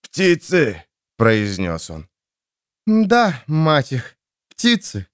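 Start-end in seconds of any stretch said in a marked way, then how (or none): 0.0-0.8 coughing. Acted. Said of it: "Кашляя и отплёвываясь". 2.9-5.1 exasperated. Acted. Exasperated reply.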